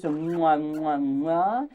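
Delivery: lightly hammed-up French accent, kisses and all; mock French accent. Host clowning at the camera in a mock French chef accent. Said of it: "We will speak like this".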